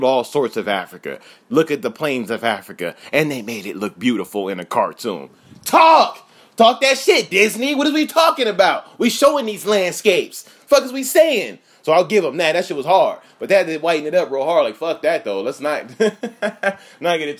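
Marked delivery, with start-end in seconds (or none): 5.7-11.6 high, loud hype; hype shout. Real, not acted. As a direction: shout it like cheering from the crowd. Hyping Disney, shouting praise.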